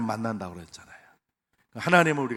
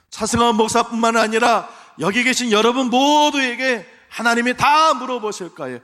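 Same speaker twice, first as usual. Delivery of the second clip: ordinary talk, nothing marked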